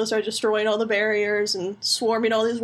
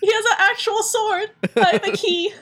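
laughing Sora voice; 11 semitones higher, 4 dB louder than usual. Retold or imitated. Sora complaining, voiced in story.

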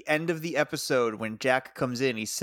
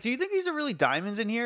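higher, fast, eager delivery guy; mock curious mover; 9 semitones higher, faster than usual. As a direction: rattle it off like a nosy worker. Delivery guy voiced, New Zealand.

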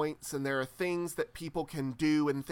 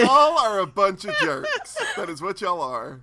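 grumpy sheep voice; 3 semitones higher, 9 dB louder than usual. Pretend. Voicing the sheep Shirley.

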